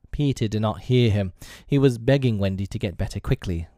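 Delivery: plain reading voice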